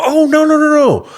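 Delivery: high, loud flustered no-nos; hasty loud denial. Retold or imitated. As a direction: backpedal fast and loud. Man's hasty retold denial.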